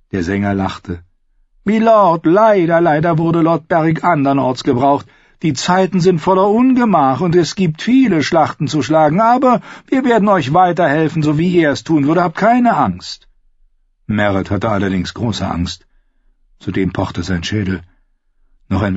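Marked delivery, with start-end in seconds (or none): 1.6-13.3 high, smooth mock-polite outlaw singer; sweetly polite while threatening; silky false courtesy. Singer figure voiced in story.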